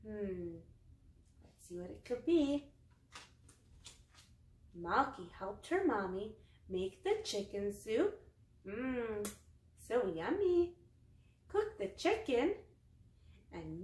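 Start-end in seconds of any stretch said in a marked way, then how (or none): none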